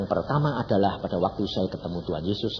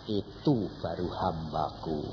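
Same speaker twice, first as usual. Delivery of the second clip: proud gentle voice; 5 semitones lower, 6 dB quieter, slower than usual. Acted. God's proud quiet words.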